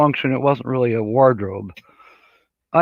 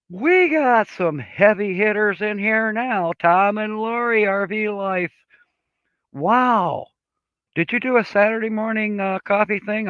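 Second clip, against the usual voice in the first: excited; 8 semitones higher than usual. Real, not acted. Host gushing to famous viewers who just joined. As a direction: gush over the famous guests.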